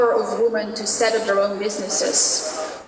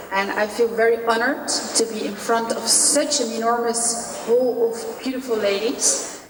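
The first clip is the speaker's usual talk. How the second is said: ordinary delivery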